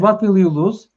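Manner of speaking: ordinary delivery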